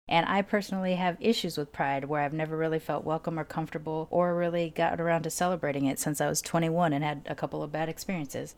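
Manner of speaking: normal talking voice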